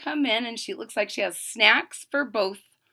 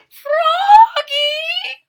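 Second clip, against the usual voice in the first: much higher, loud long call; 11 dB louder than usual. Acted. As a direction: call a kid in from far away. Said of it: "called his mom".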